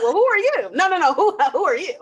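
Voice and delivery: high, incredulous mock confrontation; baffled challenge. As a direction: challenge your own reflection, playfully indignant. Acted quarrel with mirror self.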